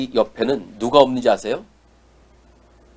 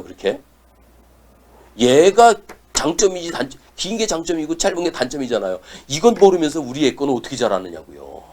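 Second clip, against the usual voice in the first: ordinary talk, nothing marked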